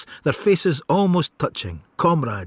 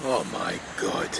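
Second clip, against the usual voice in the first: stunned hush; 14 semitones lower, 10 dB quieter than usual. Acted. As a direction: let it fall out in horrified shock. Rebus hears brother hanged.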